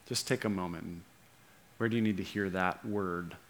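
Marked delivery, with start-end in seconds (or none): none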